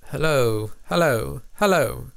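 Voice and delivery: put-on repeated hello in a playful British accent; British accent, half-hearted imitation; mock British accent. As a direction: try out a London hello a few times. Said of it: "that's how they say it".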